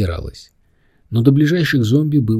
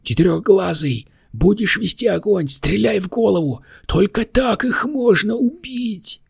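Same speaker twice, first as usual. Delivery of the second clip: very high little demon shouting orders; crying out; 12 semitones higher than usual. A narrator's reading. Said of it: "вскричал Стикити".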